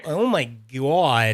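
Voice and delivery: long drawn-out, very slow Canadian "God"; exaggerated Canadian accent. Shown as a demonstration. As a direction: stretch the Canadian vowel in "God" right out. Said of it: "I could do it more. I could be like".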